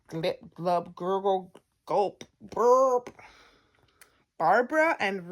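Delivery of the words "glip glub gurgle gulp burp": gulping, gurgling sound effects ending in a burp; comic swallowing sounds; swallowing noises. A narrator's reading. Bed swallows the girls.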